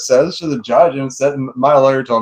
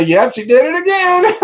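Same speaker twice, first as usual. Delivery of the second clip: mock innocent announcement; 4 dB louder than usual. Mocking ex-wife with fake news.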